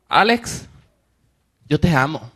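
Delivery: a hint of a sweet old lady saying it kindly. Elderly lady tells Alex she loves him, mildly sweet and tender. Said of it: "no una voz dulce".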